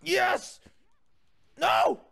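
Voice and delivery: high, loud exclamations; excited outburst. Real, not acted. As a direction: cry out in triumph, then dismay. Sudden triumph then dismay.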